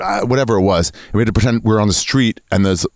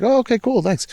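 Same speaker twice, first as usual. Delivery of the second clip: mild casual clerk; 12 semitones higher than usual. Retold or imitated. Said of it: "First one, all right".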